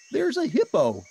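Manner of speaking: very high, loud joyful cry; Ernie crying out. A narrator's reading. Ernie seeing Bert is safe, high joyful shout.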